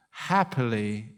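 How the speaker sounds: normal talking voice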